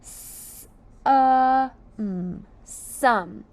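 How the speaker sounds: "S" hissed, "uh" loud, "mm" hummed, then "some" blended; phonics sounds. Shown as a demonstration. Teacher sounding out a word for children, sound by sound. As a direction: make each sound alone, then blend. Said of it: "Let's sound out some".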